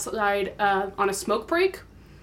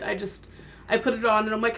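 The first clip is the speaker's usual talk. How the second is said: ordinary delivery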